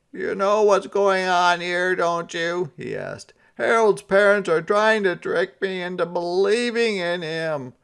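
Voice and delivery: high, loud put-on Santa voice. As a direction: play him sulky and fed up.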